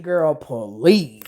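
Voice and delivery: low, drawn-out scoff; dismissive scoff. Real, not acted. Narrator scoffing at a pregnancy at forty-one. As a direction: wave the idea off.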